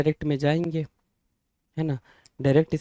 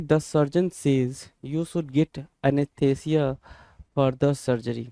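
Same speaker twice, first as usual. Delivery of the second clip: slow dictation; 3 dB quieter, much slower than usual. Shown as a demonstration. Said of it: "teacher reads sentence slowly".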